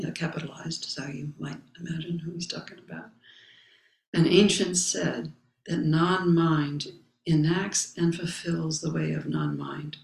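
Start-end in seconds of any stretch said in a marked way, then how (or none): 4.1-10.0 solemn text recitation. Acted. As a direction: recite each phrase with space around it. Reading the Zen text aloud.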